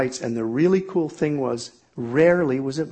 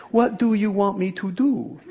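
pleading question, pitched up; exasperated higher plea to God; mock helpless plea; 5 semitones higher than usual. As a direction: whine it up at the sky. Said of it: "Don't ask that question".

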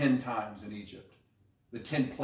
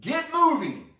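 high, loud divine command; booming command; 8 dB louder, slower than usual. Acted. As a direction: boom it out as God ordering them on. God commands people to move.

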